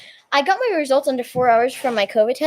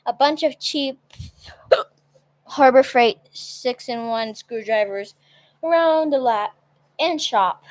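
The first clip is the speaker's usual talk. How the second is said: ordinary talk, nothing marked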